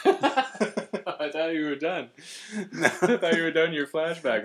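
laughing while talking; words through laughter. Real, not acted.

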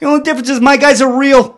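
high, loud Boston comic impression; Boston accent. Comedian teasing nerds, emphatic Boston accent. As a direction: land the punchline in mock Boston accent. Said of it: "he's got this thick Boston accent".

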